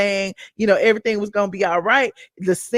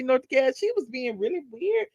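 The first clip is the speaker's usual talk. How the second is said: ordinary talk, nothing marked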